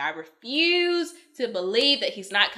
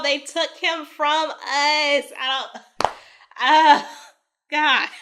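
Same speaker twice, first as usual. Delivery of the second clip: real grief; 5 semitones higher, 7 dB louder, slower than usual. Real, not acted. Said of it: "Sorry, I'm getting emotional".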